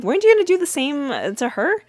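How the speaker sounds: quick, loud, incredulous challenge; pointed comeback. Retold or imitated. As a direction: fire the question right back at him. Cliff calls out Theo's hypocrisy.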